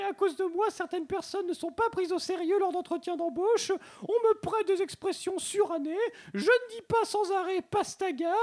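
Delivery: put-on very high-pitched, indignant Marseille accent; Marseillais accent. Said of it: "je reçois l'accent marseillais". (accent marseillais haut perché, indigné)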